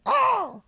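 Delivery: high mock dinosaur shriek; dinosaur screech. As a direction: squawk like a little dinosaur. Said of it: "Is that what a dinosaur sounds like?".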